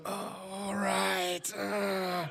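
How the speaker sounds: very soft, drawn-out hesitant mumble; hammed-up feeble agreement; awkward stalling. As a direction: stall slowly and quietly, unsure of yourself. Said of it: "started going".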